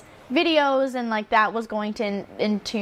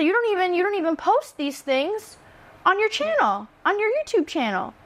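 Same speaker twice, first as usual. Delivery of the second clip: a lightly voiced principal, higher and incredulous; retold boss scolding, pitched up in disbelief; mock scolding principal; 8 semitones higher than usual. Principal scolding teacher about her channel, mock incredulous.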